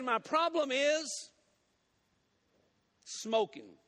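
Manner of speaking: high, slow build, then a long pause before the drop; dramatic reveal. Acted. Preacher revealing the deacon's problem, slow and drawn out.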